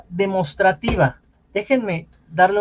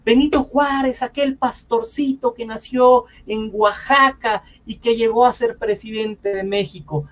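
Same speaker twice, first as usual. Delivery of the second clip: schoolchild orator; 4 semitones higher than usual. Acted. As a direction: imitate a child reciting a patriotic speech. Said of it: "ves al niño el veintiuno de marzo que está".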